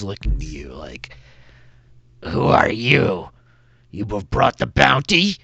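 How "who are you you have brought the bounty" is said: kobold guard. Acted. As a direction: demand it like a suspicious kobold sentry.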